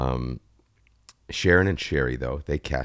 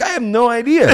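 much higher, louder, laughing put-on voice; in character voice; 13 dB louder than usual. Actor answering director Bill in character, laughing and higher. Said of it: "Where's the accent from?".

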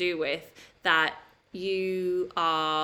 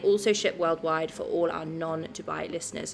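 upbeat sponsor read with discount details; 4 dB louder than usual. Acted. Co-host giving listeners the discount code: upbeat ad read. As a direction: deliver the code and offer clearly and brightly.

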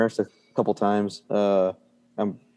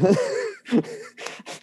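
high-pitched laugh; amused laugh; 3 dB quieter than usual. Real, not acted. Patient laughing over his own medic story, high laugh.

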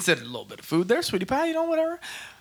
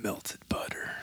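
breathy sultry whisper; 8 semitones lower, 13 dB quieter, much slower than usual. Pretend. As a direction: whisper it like seduction. Sensual whisper about food.

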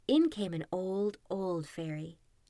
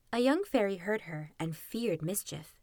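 ordinary delivery

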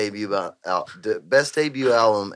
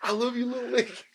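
high, soft put-on voice; film character quote; 11 semitones higher, 9 dB quieter, faster than usual. Closing the movie bit, high soft impression. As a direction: say it up high and small.

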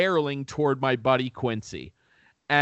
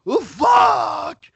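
high, loud startled "Oh, fuck!", imitated; startled yell; 13 semitones higher, 7 dB louder than usual. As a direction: yelp like someone who just saw a snake. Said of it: "and goes".

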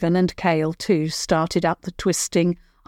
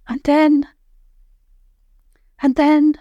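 louder, much higher stalling "and then, and then"; hesitant build-up; 9 semitones higher, 5 dB louder than usual. A narrator's reading. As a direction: work up the nerve with "and then" repeated loud and high. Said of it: "She said the last sentence very quietly".

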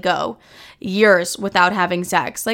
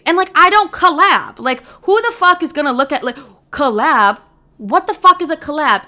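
higher and louder, disbelieving; indignant rant; 6 semitones higher, 3 dB louder than usual. Real, not acted. Ranting to listeners about the collab request, indignant. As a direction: rant like the idea is absurd.

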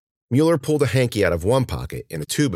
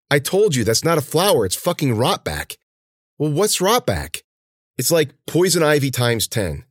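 put-on stern young woman; 7 semitones higher than usual. Cece scolds the newcomer.

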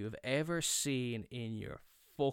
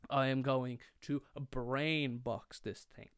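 slow, word-by-word declaration; mock-solemn, spacing out every word; deliberate resolve; slower than usual. Repeats resolve with heavy deliberation.

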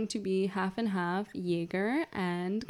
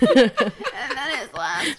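put-on slow slurry drunk voice; 5 semitones higher, 10 dB louder, much slower than usual. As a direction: slur the words, half-drunk. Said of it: "Your drunk voice is Irish".

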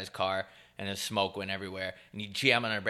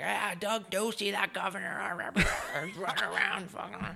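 lightly impersonating a political Uber driver; mock driver rant; 9 semitones higher than usual. Uber driver ranting about politics.

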